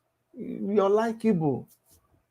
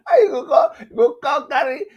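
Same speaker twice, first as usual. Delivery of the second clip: laughing through the words; 7 semitones higher, 6 dB louder than usual. Real, not acted. Laughing at a rival while asking. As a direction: laugh as you ask.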